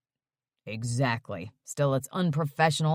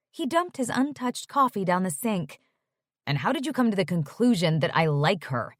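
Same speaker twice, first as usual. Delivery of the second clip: usual speaking voice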